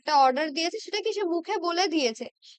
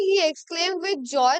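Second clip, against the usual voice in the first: slow dictation; much slower than usual. Shown as a demonstration. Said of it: "বাকিগুলো দেখি".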